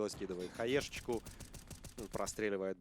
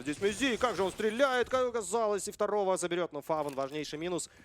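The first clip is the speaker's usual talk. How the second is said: higher, louder hype call; excited shout; 9 semitones higher, 6 dB louder than usual. Real, not acted. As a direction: yell the highlight play. Caster calls a multi-kill.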